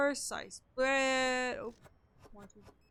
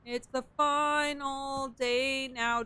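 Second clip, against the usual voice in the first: very slow stretched words; drawn out; 2 semitones higher, much slower than usual. Real, not acted. Sleepy streamer dragging out the final day announcement. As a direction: stretch every word out lazily.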